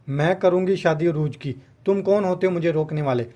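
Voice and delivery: usual speaking voice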